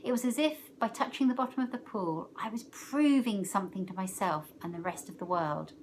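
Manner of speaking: ordinary delivery, nothing marked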